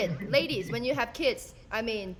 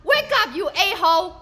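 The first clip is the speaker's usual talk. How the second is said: high, loud wake up calls; mock wake-up yell; 7 semitones higher, 8 dB louder than usual. Son waking mom on Mother's Day, loud high yell. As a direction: shout her awake. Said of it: "my son was singing this".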